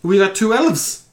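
high, mock-Scottish dwarf; Scottish dwarf accent. Shown as a demonstration. Game master's dwarf reporting elves, Scottish accent. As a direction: announce it loudly in put-on Scottish. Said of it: "Scottish accent for the dwarf".

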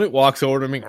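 ordinary talk, nothing marked

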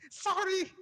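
high timid apology; tiny flustered sorry; squeaky sorry. Retold or imitated. Manager apologizing again.

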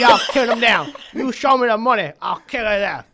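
loud, high, furious Cajun ranting; shouting Cajun fighter spoiling for a war with the British; angry Cajun man. Acted. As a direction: bellow it like a furious backwoods Cajun out for revenge. Acadian ranting to the Spanish.

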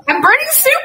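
sudden high alarmed shout; alarmed cry. Real, not acted. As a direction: yelp it out in panic. Soup burning, alarmed.

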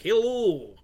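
a hint of a hammy Russian accent, lightly put on; mock eighties villain Russian accent. Co-host imitates Mickey Rourke's villain in hammy Russian accent. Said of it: "hammy as fuck Russian accent".

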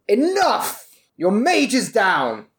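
high-pitched commanding bellow. Acted. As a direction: bellow it to make them surrender. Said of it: "just bellow".

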